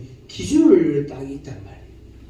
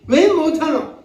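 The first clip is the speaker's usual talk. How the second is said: scolding; 8 semitones higher than usual. Acted. Acted scolding for congregation to repeat.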